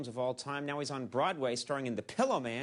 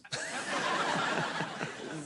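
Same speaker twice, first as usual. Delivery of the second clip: airy laugh at own joke; breathy laugh; 12 semitones higher than usual. Real, not acted. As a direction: laugh silently through the breath.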